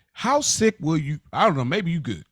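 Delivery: ordinary talk, nothing marked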